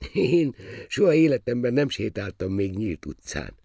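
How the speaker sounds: high, innocent confession; sheltered royal boy; naive young prince. A narrator's reading. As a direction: explain earnestly in a high voice.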